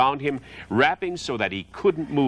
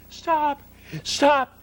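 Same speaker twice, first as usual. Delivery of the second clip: high, frantic pig cry; panicked pleading; 10 semitones higher than usual. Acted. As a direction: beg for your life, panicking. Wilbur panics about being killed.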